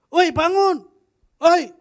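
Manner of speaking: high, loud shout into an ear; a sudden loud holler right at the ear; yelling wake up. Retold or imitated. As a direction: yell to wake a sleeping lion. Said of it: "diteriakin misalnya di telinga".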